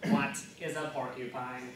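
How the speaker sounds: low robotic monotone; a canned robot question. Acted. Android plays backup phrase, robot voice. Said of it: "record them in a robot voice".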